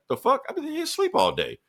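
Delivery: high, squeaky protest; indignant shock. Retold or imitated. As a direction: yelp it in outraged disbelief. Woken hero protests arrest.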